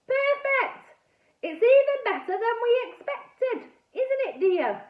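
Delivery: high, loud gushing delight; delighted frogman. A narrator's reading. Frogman gushing to his wife, high and delighted. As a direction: hit a high, thrilled pitch. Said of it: "and he said".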